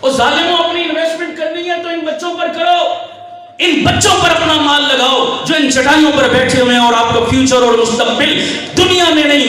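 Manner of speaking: high, impassioned appeal to the crowd; preaching at full force. Real, not acted. Preacher urging listeners to invest in these children, full-force preaching.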